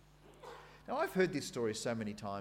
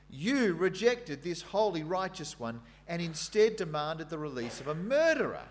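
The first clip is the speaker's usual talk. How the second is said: louder, higher bold proclamation, slowing at the end; preaching Peter's speech; 4 semitones higher, 5 dB louder than usual. Acted. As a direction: proclaim it to the crowd. Reads Peter's speech aloud.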